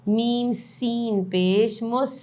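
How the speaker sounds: spelling drill. Shown as a demonstration. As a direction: call out each letter and vowel for students to repeat.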